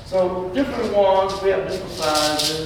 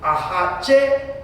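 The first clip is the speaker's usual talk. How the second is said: "hache" with a high, accented ending; stress on final syllable; 7 semitones higher than usual. Shown as a demonstration. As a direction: demonstrate "hache", jumping up on the last syllable. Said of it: "So the accent".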